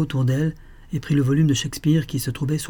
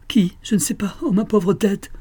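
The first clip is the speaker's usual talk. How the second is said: anguished; 10 semitones higher than usual. A narrator's reading. Clutching her head in anguish.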